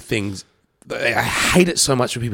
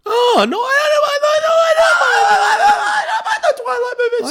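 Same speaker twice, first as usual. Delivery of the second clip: mock wail. Co-host reacting to the Twilight reveal, mock high wail. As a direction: squeal it in exaggerated falsetto panic.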